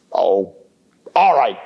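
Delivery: low, grudging troll voice. Acted. Troll letting the middle goat pass, low and grudging. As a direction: give in reluctantly.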